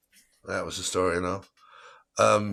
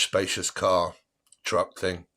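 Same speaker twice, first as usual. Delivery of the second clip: ordinary talk, nothing marked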